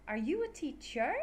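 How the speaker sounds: "teacher" rising at the end; rising intonation. Shown as a demonstration. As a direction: lift "teacher" like a yes-no question. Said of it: "Goes up".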